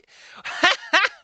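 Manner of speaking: real laugh after the joke; giddy laugh; high burst of laughter. Real, not acted. Laughs at own outburst.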